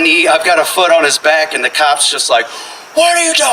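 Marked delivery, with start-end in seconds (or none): 2.9-3.5 high, urgent cop challenge; pitched-up mock police question. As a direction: imitate an officer demanding answers. Cop arrives and challenges him.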